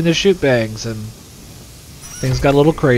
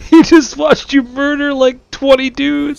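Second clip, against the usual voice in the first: very high, loud, slow squeaky voice; shocked little witness; 13 semitones higher, 5 dB louder, slower than usual. Acted. Gamer voicing the shocked child after a shootout, squeaky horror.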